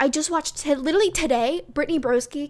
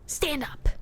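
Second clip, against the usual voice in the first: whispered stand up. Shown as a demonstration. Vlogger urging Kaylor to leave, whispering "Stand up.". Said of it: "Forget him".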